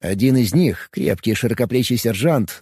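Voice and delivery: ordinary delivery, nothing marked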